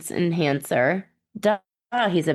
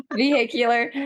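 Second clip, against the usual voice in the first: Vehicular said through a laugh; 5 semitones higher than usual. Real, not acted. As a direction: laugh while getting Vehicular out. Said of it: "I can never say this word".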